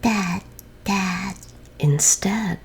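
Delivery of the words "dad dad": higher, lightly voiced baby; a hint of a baby saying Dad; baby calling Dad. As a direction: coo Dad like a toddler. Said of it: "so she said".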